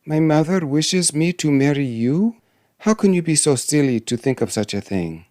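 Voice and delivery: high-pitched disbelieving question; astonished princess. Acted. Princess questions the dwarf's proposal, astonished. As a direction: raise the voice in affronted disbelief. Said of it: "cried Bellissima, starting back".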